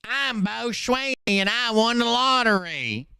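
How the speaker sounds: lampoon a lottery winner's self-introduction; Bo Sweeney impression. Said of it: "How many people remember Mister Bo Sweeney?".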